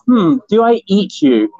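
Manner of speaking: lightly voiced curious kitten; a hint of a hungry kitten wondering; kitten's thoughts voiced. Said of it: "impression of".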